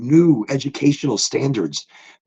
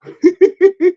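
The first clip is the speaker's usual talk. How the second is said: high burst of laughter; 5 dB louder than usual. Real, not acted. Laughing after mule fuel.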